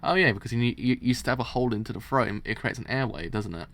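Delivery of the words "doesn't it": plain reading voice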